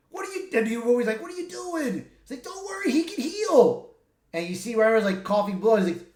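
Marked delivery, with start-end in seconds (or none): 0.1-3.9 high, excited cries of disbelief; incredulous yelling. Retold or imitated. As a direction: toss out high shocked lines in the fight. Wolverine and Deadpool yell mid-fight.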